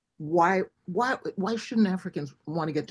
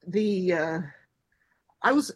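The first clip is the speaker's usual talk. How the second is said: normal talking voice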